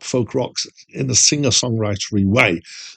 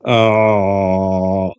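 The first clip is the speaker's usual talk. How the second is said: ah with tongue pulled back, swallowed and dark; curled vowel; 3 semitones lower than usual. Shown as a demonstration. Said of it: "more what I think of as curled".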